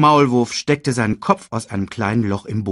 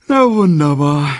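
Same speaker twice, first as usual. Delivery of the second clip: loud, raised mock delight; bored sarcasm; 7 semitones higher, 9 dB louder than usual. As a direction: feign delight at the cub's news. Scar's bored sarcasm after yawning.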